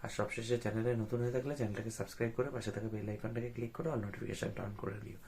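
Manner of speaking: speaking softly. Real, not acted. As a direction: keep it low so mom stays asleep. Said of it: "আস্তে আস্তে কথা বলছি".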